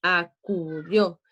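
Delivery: "acudió" in slow beats, a-cu-DIÓ; slow, measured "acudió" with accent on "dió"; rhythmic final stress. Shown as a demonstration. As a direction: tap out "acudió" evenly, landing on the last syllable. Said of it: "tiene un ritmo".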